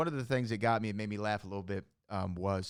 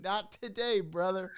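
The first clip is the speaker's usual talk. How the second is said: much higher mocking replay; mock mom voice; 9 semitones higher than usual. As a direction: chirp it like the mother. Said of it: "repeating the punchline".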